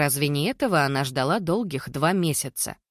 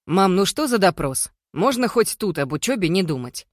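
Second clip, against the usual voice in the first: higher, louder exasperated complaint; annoyed protest; 4 semitones higher, 4 dB louder than usual. Acted. Son objecting to his mother's interrogation of his guest, exasperated. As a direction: push back at a nagging parent.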